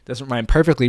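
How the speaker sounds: ordinary talk, nothing marked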